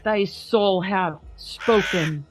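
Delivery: slow, grave recital of Poe; dramatic poem reading. Acted. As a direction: read it like a gothic poem by candlelight. Reads poem aloud.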